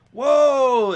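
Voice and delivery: high, loud call to horses; driver's shout. Acted. Carriage driver pulls on the reins, shouting loudly. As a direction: holler to halt the team. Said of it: "as the woman pulls back on the reins".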